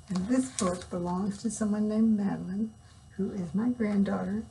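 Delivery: usual speaking voice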